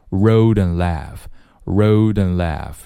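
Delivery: "road and laugh" run together as "roa-dn-laugh"; "and" shrunk to a bare n between "road" and "laugh"; linked words. Shown as a demonstration. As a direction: link "road and laugh", reducing and to n. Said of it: "然后连起来".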